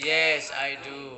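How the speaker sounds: loud, drawn-out classroom English; slow, projected example answer; slow model answer. Shown as a demonstration. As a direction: stretch each word for learners. Teacher models English sentence for class.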